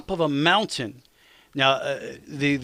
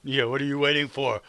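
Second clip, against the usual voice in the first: ordinary talk, nothing marked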